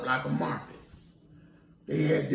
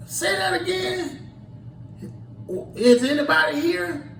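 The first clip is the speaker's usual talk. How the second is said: calling out; 10 semitones higher than usual. Retold or imitated. Calling out in total darkness.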